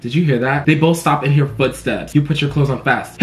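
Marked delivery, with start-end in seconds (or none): none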